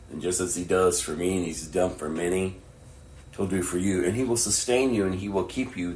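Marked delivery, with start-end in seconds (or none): none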